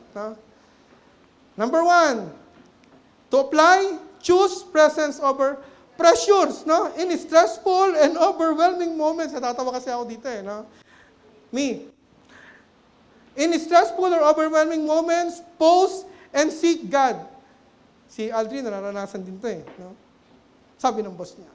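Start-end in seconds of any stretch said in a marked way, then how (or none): 1.6-9.3 slow, louder, emphatic preaching; measured pulpit proclamation. Acted. Preaching at full force. 13.4-17.2 slow, louder, emphatic preaching; measured pulpit proclamation. Acted. Preaching at full force.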